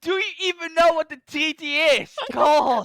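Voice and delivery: high, loud exasperated shouting; mock angry yelling. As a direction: yell at them in fake outrage. Mock outrage at teammate.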